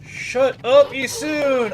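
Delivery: high, loud frustrated yell; real exasperated outburst. Real, not acted. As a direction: yell it at someone who won't stop nagging. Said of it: "I'm so frustrated by this".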